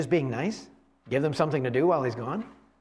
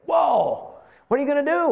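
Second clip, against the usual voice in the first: amazed friend; 9 semitones higher, 3 dB louder than usual. Acted. Friend reacts on the phone.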